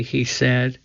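usual speaking voice

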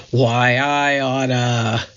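slow, gruff tough-guy threat; gangster bark. Acted. Moe threatening the others in a slow mock bark. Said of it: "barked things like".

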